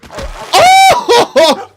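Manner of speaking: high loud yelp breaking into laughter; shocked laughing yelp. Real, not acted. Sudden shock then laugh.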